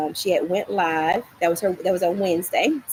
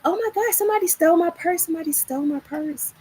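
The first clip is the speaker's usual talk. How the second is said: frantic; 10 semitones higher, faster than usual. Retold or imitated. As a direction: spill it out in a rush of panic. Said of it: "I was frantic".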